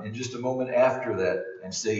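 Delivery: usual speaking voice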